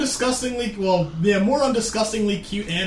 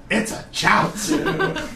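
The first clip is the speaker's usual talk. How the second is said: laughing; slower than usual. Retold or imitated. Mock Pokemon trainer, laughing.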